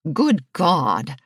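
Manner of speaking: shocked male curse; man cursing. A narrator's reading. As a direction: blurt the curse in a lightly voiced man. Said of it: "a man cursed".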